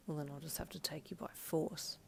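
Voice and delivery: breathy threatening whisper. A narrator's reading. Husband teasing wife, in a whisper. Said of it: "he replied".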